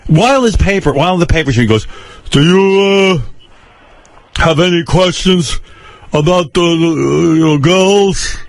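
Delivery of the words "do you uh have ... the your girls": put-on father's Eastern European accent, higher and slow, every word over-pronounced; over-enunciating father. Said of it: "he tries to correct his accent by over-enunciating".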